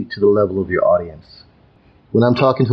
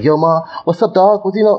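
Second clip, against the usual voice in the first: hyped-up, much higher street slang greeting; casual street greeting; 9 semitones higher than usual. Retold or imitated. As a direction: call out to a buddy like a kid off the block. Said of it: "somebody comes up to me like".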